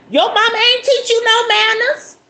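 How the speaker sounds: very high, loud mock scolding; scolding Southern mama. Southern mama to a rude guest, high scolding holler. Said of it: "Come on, Southern mamas. Be like".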